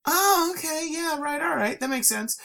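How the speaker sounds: high, sudden realization; realizing. Retold or imitated. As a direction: it all clicks suddenly. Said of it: "it's just like".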